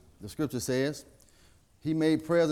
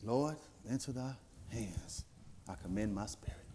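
dying whisper-soft words; 6 semitones lower, 17 dB quieter than usual. Acted. Jesus giving up his spirit, very soft and low. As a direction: breathe the last words out.

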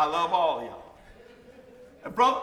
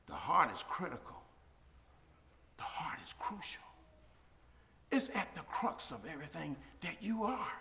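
whispered, soft intimate preaching; hushed; 6 semitones lower, 14 dB quieter than usual. Acted. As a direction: drop to a whisper to draw them in.

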